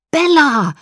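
loud high shout of her name. A narrator's reading. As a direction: yell her name in alarm. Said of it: "schrie Jacob".

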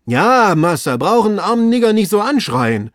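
high, loud, aggrieved reply; wounded servant calling out; protesting servant. Acted. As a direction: complain back down at the master. Servant protests at being scolded.

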